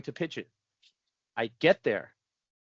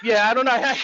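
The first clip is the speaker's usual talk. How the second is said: laughing; 11 semitones higher, 6 dB louder than usual. Real, not acted. Laughing through embarrassed answer.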